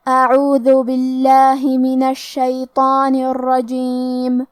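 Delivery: slow, melodic tajweed chanting; Quran recitation. Acted. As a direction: chant each verse slowly, stretching the vowels. Full recitation of Surah al-Jinn. (آہستہ، سُریلی تلاوت)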